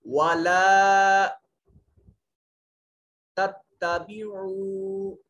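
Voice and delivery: वाला chanted slow and loud, the ला held long; recited long. Shown as a demonstration. Teacher recites the corrected word for students, loud slow chant. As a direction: intone वाला with a stretched ला. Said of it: "यहाँ लाम को लंबा करेंगे".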